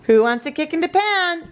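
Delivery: high, mock-enthusiastic teasing question; playful baby talk. Parent playing kick-in-the-pants with baby, playful baby talk. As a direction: tease a baby in a lifted voice.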